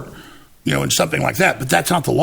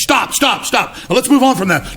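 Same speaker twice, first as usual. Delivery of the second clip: higher, louder hurried halt; urgent stop; 7 semitones higher, 3 dB louder than usual. Retold or imitated. Prosecutor halting the reading, urgent and loud. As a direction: cut him off fast. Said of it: "Chris May goes".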